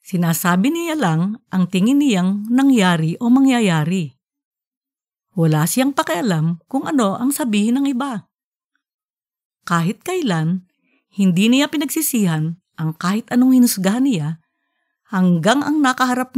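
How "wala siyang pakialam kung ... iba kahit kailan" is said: ordinary delivery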